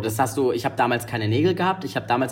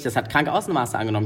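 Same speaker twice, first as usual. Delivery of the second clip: normal talking voice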